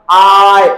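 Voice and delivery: आई held long and sliding into its ending; long vowel. Shown as a demonstration. Teacher showing the long sound, drawn out. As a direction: stretch आई out as one long sound. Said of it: "इसको long जब pronounce करेंगे".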